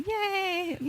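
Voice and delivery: cheering herself on; high excited yay. Real, not acted. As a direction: squeal yay up high and bright. Cheering at own set ending.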